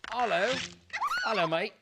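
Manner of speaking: a mild British neighbour greeting; British accent. Retold or imitated. Gamer mimicking a British neighbour with a light British accent. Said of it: "and he is British".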